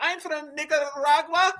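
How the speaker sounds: high, slow imitation of a lady caller's Nicaraguan accent; mock Nicaraguan accent. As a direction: stretch out a put-on Nicaraguan accent. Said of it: "Some lady called in a long time ago".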